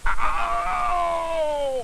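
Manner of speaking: high, drawn-out ow; howl of pain. A narrator's reading. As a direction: wail as if bitten. Dentist's thumb is bitten.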